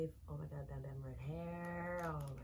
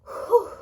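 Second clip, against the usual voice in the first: big loud high exhale of relief; loud relieved whew; 20 dB louder than usual. Real, not acted. Relief after hard moment.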